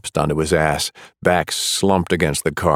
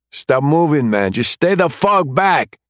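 high, strained yell at the cop; panicked yell; 10 semitones higher, 3 dB louder than usual. Acted. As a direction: shout him back, voice cracking high. Armed suspect yelling at cop.

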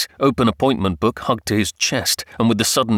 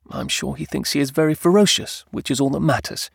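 higher-pitched wife, playful; fond wife; 5 semitones higher than usual. Acted. Wife presents kitten to husband, fondly playful. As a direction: voice the wife indulging her pet. Wife's voice in story.

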